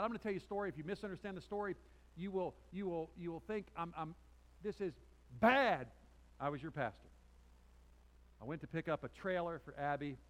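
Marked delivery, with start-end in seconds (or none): 4.6-5.9 one loud, high, punched word; sudden loud emphasis; emphatic exclamation. Real, not acted. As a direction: burst out with it. Emphatic warning about the story.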